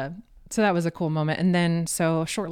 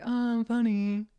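slow, higher, dismissive oh funny; sarcastic; 6 semitones higher, slower than usual. Retold or imitated. Hunter thinks his partner is teasing him, slow sarcastic retort. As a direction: brush it off as a joke.

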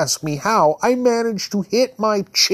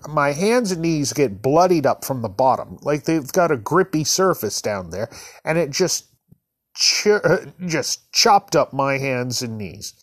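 ordinary delivery, nothing marked

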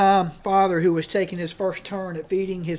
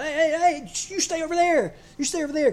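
much higher mock warning call; disciples shooing away; 10 semitones higher than usual. Disciples warning a leper to keep back, high mock shout. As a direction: wave the leper off in a raised voice.

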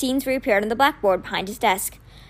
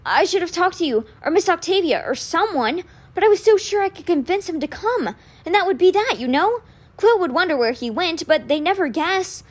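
higher-pitched, distressed confession; groaning regret; 7 semitones higher than usual. A narrator's reading. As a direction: pour out regret in a pained voice. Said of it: "he groaned".

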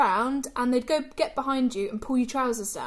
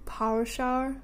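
questioning attempt at a Northern Irish accent. Shown as a demonstration. Asked by co-host to say it, attempted Northern Irish accent. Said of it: "Northern Irish accent".

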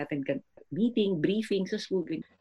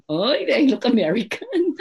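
1 mock reply; 8 semitones higher, 6 dB louder, slower than usual.

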